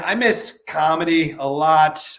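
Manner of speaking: ordinary delivery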